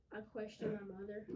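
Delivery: ordinary delivery, nothing marked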